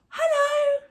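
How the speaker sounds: excited hello. Shown as a demonstration. Teacher greeting someone she's thrilled to see, excited. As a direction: burst out with delight. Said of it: "I'm excited to see".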